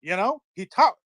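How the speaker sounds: mock Southern I-told-you-so; imitated Southern accent. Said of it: "from a guy who talks like this".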